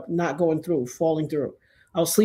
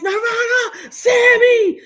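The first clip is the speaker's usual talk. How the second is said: high, excited reenacted yelling; acted wild shriek, getting louder; buck wild; 7 dB louder, much slower than usual. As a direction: holler both names, losing it with excitement. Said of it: "I went buck wild".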